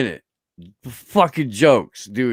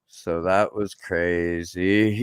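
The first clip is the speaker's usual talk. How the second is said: dazed understatement; 7 semitones lower, 6 dB quieter, much slower than usual. Retold or imitated. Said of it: "being like".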